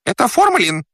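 very high, squealed call from behind a door; squealing voice. Acted. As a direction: squeal it out, pitched far up. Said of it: "раздался визгливый голос". (визгливый голос, очень высоко)

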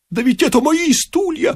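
high, pained wail; moaning. A narrator's reading. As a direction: moan it high, pleading for what's yours. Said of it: "простонал отец Фёдор". (простонать тонким голосом)

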